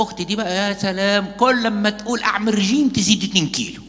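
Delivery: high, loud teasing jeer; mocking brother. Preacher mimics a brother mocking his sister, loud and high. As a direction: tease your sister in front of everyone. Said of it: "يقول لها".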